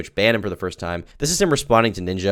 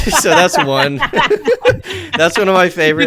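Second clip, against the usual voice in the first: talking through real laughter, higher and louder; can't hold the laugh in; 7 dB louder than usual. Real, not acted. Host introduces the clip while cracking up, laughing.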